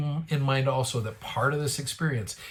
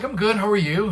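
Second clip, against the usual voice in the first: high, louder, beaming phone reply; upbeat reply; 9 semitones higher, 7 dB louder than usual. Retold or imitated. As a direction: answer bursting with good news. Healed man calling on the phone.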